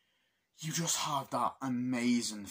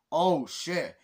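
loud, raised outburst of shock; mock shocked shout; 5 semitones higher, 8 dB louder than usual. Viewer watching the plane scene, loud mock shock. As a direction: shout it in imitated alarm.

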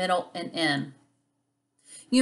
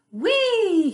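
high, loud, drawn-out squeal of glee; gleeful whee; 13 semitones higher, 5 dB louder than usual. Acted. Octopus races through the water, high gleeful whee. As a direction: let it fly up high, thrilled.

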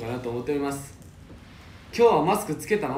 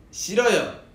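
higher, louder polite no; playful refusal; 7 semitones higher, 6 dB louder than usual. Real, not acted. Idol turns down a fan's concert spoiler request, playful refusal. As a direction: refuse with a quick raised burst.

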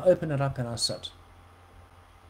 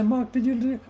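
incredulous listener; 9 semitones higher, 4 dB louder than usual. Retold or imitated. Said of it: "You say".

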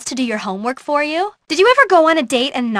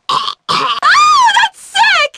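squealing excitement; 4 dB louder, much slower than usual. Acted. Excited reaction line.

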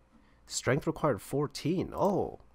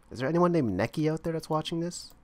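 usual speaking voice

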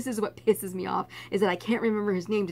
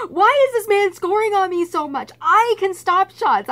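exasperated rant; 11 semitones higher, 10 dB louder than usual. Retold or imitated. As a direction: rant at yourself in disbelief. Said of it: "losing my mind".

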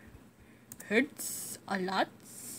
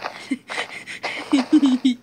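laugh; 3 semitones higher, 7 dB louder than usual. Real, not acted. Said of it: "I keep laughing".

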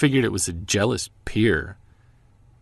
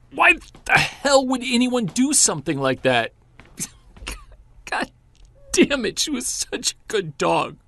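anguished outburst; 12 semitones higher than usual. Acted. Husband grieving his dog to his wife, anguished. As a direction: voice cracking high with grief and anger.

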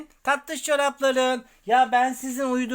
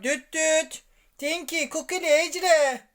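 calling out; 6 semitones higher, slower than usual. Real, not acted. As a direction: call out to wake them. Calling loudly to wake toys.

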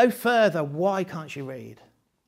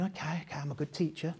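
inner thought; 2 semitones lower, 10 dB quieter, faster than usual. Retold or imitated. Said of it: "I'm thinking".

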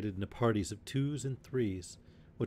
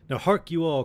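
loud and high call to the band; rallying cry; 7 semitones higher, 5 dB louder than usual. A narrator's reading. Robin summoning his gathered yeomen, loud rallying call. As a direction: call the whole band to attention. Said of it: "cried Robin".

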